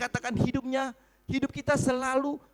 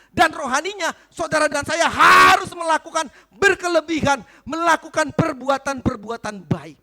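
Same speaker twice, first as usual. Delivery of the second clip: loud, forceful preaching; khotbah berapi-api; 5 semitones higher, 12 dB louder than usual. Real, not acted. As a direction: preach it out to the whole room, stretching key words. (berkhotbah lantang dan berapi-api)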